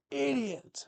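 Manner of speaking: high, squeaked-out "Idiot!" as a playful jab; mock insult. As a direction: fling the word up high, teasing the chat.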